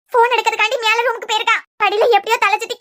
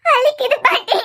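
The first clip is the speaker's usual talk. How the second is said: in labour pain; 7 semitones higher, slower than usual. Acted. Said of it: "வலியில துடிச்சுக்கிட்டு இருக்கேன்".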